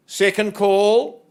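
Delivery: slow, loud, stretched auction call; drawn-out call. Acted. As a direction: draw out the call to the room.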